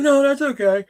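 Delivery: high imitation of a customer declining. Customer turns down the clerk's offer, high mimicked voice. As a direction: lift the voice into a polite shopper.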